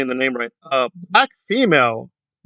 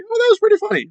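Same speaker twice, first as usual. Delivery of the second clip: much higher, delighted "that was pretty funny"; amused chuckle; faster than usual. Retold or imitated. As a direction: burst out pleasantly surprised. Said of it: "but I was like".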